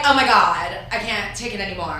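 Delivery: put-on Valley Girl, loud start; hammed-up Valley Girl exasperation; Valley Girl accent. Shown as a demonstration. Host demonstrates for guest, Valley Girl accent. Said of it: "there's the Valley Girl".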